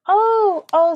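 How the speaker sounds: high, loud delighted gasp of oh; excited oh. Real, not acted. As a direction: squeal the oh with joy. Excited reaction to viewer comment.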